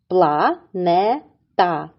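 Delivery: Планета drawn out slowly, syllable by syllable. Shown as a demonstration. Teacher slowly splits Планета for the kids, syllable by syllable. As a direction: stretch out Планета in three beats. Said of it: "поділимо його на склади".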